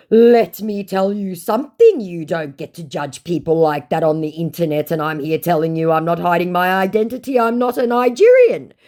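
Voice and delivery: high, loud indignant tirade; mock scammer. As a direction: rant as the scammer, rising to the denial. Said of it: "said my definitely Nigerian scammer".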